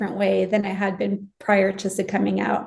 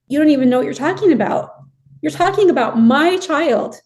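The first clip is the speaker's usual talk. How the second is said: raised, indignant outburst, voice climbing higher; livid; 6 semitones higher, 5 dB louder than usual. Retold or imitated. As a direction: fire it back in outrage, high and loud. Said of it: "I was just livid".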